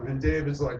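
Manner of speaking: usual speaking voice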